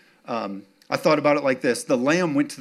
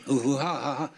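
ordinary talk

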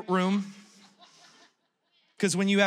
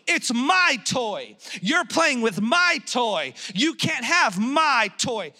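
slow, drawn-out indignant protest; stretched-out mock yell; mock child yelling; 4 semitones higher, slower than usual. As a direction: imitate a small boy yelling over his toy. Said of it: "He's yelling at her".

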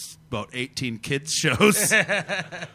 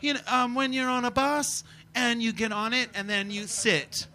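put-on high little-girl stand-up voice; little daughter; 7 semitones higher, 4 dB quieter than usual. Dad imitating his daughter's stand-up routine, high child voice. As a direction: play a small child trying out observational comedy. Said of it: "My daughter was doing observational material".

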